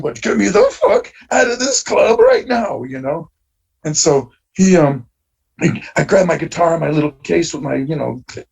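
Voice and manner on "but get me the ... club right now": high, urgent retold demand; urgent plea. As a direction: press the roadie to get you out now. Urgent order to his roadie.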